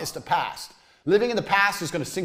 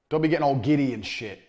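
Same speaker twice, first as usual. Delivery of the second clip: ordinary talk, nothing marked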